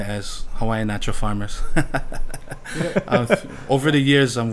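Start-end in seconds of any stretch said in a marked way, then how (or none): 1.7-3.0 a short airy laugh; breathy chuckle. Real, not acted. Guest chuckling at calling himself a Hawaiian natural farmer. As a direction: chuckle at your own wordplay.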